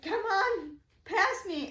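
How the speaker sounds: high, loud, frantic pleading, re-enacted; retold hysterical. Stranded driver waving cars past, retold hysterical pleading. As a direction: act out begging drivers in a panic. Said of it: "hysterical. I'm, like, freaking out".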